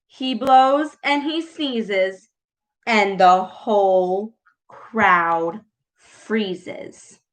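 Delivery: gentle storyteller voice; read-aloud storytelling. A narrator's reading. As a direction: read it like a bedtime story to kids. Reads picture book aloud.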